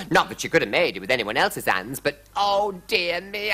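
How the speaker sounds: hurried royal fretting; fretting queen. A narrator's reading. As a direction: fuss as a mildly distraught queen. Queen's lament continues.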